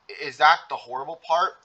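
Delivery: plain reading voice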